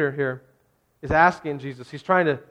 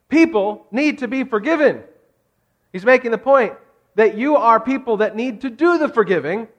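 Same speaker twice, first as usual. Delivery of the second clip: louder and higher, urgent sermon build; preaching at full force; 6 semitones higher, 5 dB louder than usual. Real, not acted. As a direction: push the message to the back row. Preaching climax, loud and high.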